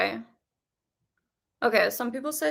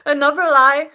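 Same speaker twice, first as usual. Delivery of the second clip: louder, laughing mock outrage; 3 semitones higher, 9 dB louder than usual. Real, not acted. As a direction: laugh it out in disbelief. Joking about the twist.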